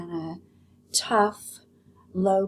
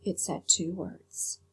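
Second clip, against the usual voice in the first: usual speaking voice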